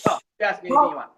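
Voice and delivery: 热情地催促对方尽管问; emphatic and eager. Real, not acted. Guest emphatically repeats the invitation.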